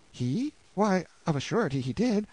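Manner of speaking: put-on high, trembling plea of a desperate young wife; pleading wife. Anguished wife begging the lord to persuade Hugo, high pleading voice. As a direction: beg him to save your husband.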